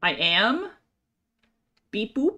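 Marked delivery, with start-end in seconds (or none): 1.9-2.4 toy robot voice. Pretend. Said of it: "Are you a transformer now?".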